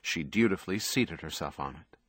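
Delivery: usual speaking voice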